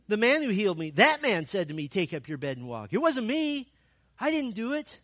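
high, loud defensive whine; passing the blame, protesting innocence; defensive blaming man. Acted. Healed man to leaders, a loud defensive protest.

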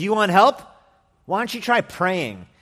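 high, loud mocking retort; sarcastic king brushing off a beggar. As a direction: throw the plea back scornfully. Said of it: "A very sarcastic response".

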